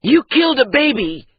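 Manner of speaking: high, loud furious shout. Acted. Maati shouting at the andat, shocked at his own anger. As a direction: hurl the accusation. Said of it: "Maati shouted".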